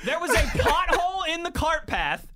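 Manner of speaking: high, loud punchline; building to an excited shout. Real, not acted. Punchline of golf story.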